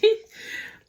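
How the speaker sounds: short, airless laugh; breathy burst of laughter. Real, not acted. Telling viewers about the bad baby, laughing. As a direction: laugh out loud at the joke.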